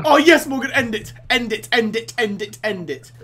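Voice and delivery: loud, high, repeated urging; frantic pleading. Real, not acted. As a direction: beg at full voice, desperate. Begs partner to end level.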